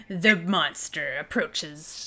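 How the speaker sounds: creepy monster-story narration. Acted. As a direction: draw it out ominously. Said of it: "like, a really creepy".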